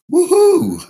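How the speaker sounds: a big high whoop of joy; excited woohoo. Retold or imitated.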